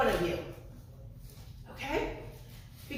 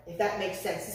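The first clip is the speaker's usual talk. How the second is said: ordinary talk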